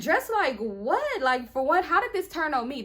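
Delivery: high, loud incredulous comeback; indignant retort. Retold or imitated. Girlfriend defending herself against her boyfriend, indignant. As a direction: stretch the first question out, then push back hard. Acted argument, hurled back at boyfriend.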